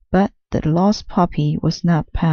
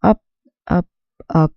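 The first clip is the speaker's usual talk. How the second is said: ordinary delivery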